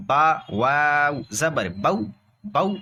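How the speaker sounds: slow spelling chant, then بو cut short with a jolt; jerked بو. Shown as a demonstration. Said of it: "اگر آپ اس کو جھٹکا دے کر پڑھیں".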